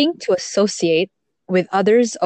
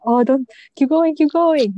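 nervous; 7 semitones higher than usual. Retold or imitated. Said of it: "I was nervous".

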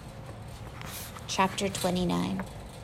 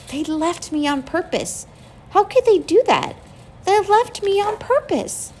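rising, louder hurt outcry; hurt anger; 11 semitones higher, 5 dB louder than usual. A narrator's reading. Girl alone in bed feels abandoned, hurt angry cry. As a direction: let the betrayal break out, climbing higher.

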